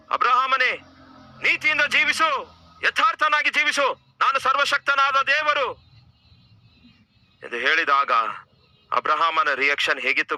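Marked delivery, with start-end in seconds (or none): 0.1-5.9 voice of God. Acted. God appears and commands Abraham, high booming proclamation.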